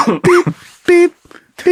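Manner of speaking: high-pitched imitated electronic beep; metal detector beeps. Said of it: "like, metal detector".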